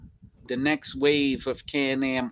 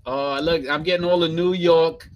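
usual speaking voice

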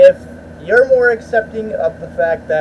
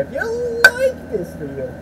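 squeaky, over-the-top call to viewers; hammed-up outro plea; 4 dB quieter than usual. Real, not acted. Vlogger asking viewers for likes, hammed-up high squeal.

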